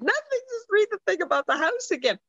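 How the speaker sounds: squeaky, high-pitched mock hysterics; hysterical with laughter. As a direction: imitate producers barely holding it together, very high. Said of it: "they're just hysterical".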